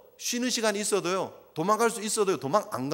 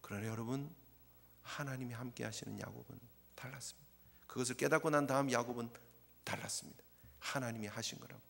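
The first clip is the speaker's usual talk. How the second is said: much softer, intimate; softer, quiet conviction; hushed preaching; 7 semitones lower, 12 dB quieter than usual. Real, not acted. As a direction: drop to a near hush. Hushed sermon point.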